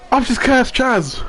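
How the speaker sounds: high, stunned exclamation; shocked disbelief. Real, not acted. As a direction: blurt it out in shock. Reacting to crash on stream.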